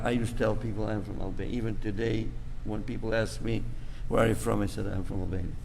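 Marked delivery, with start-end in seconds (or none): none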